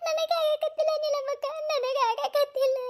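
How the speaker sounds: high, slow tearful cry; sobbing plea. Acted. Wife cries to Neelammakka that she cannot bear it, sobbing. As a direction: break down pleading.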